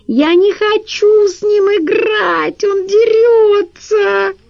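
put-on high, slow tearful child's voice; little girl crying to her parents about her brother. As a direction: sob it out like a small hurt girl.

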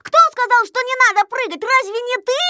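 high-pitched indignant crowd voice; accusing shout. A narrator's reading. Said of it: "закричали тут все". (возмущённо кричать, обвиняя)

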